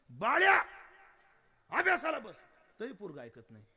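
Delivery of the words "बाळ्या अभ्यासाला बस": put-on loud, high call to a child; raised voice, ordering the child to study; mother losing patience. Mother calling her son who will not listen, loud scolding.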